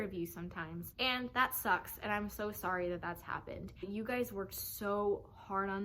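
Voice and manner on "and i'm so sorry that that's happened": ordinary delivery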